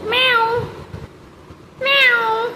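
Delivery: high, drawn-out meow; cat meow. Shown as a demonstration. Mother meowing for the toddler to copy how a cat sounds. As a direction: meow like a kitten to teach the baby. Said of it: "माऊ कशी करते?".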